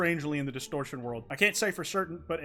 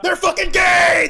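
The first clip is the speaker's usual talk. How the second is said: very high, loud slow shout; joyful shout; 14 semitones higher, 13 dB louder, slower than usual. Real, not acted. Streamer celebrating the couple, shouting. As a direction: scream it in triumph.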